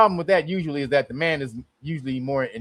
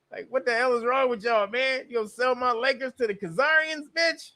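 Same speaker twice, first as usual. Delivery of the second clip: high indignant rant; 9 semitones higher, 3 dB quieter than usual. Real, not acted. Outraged fan addressing owners.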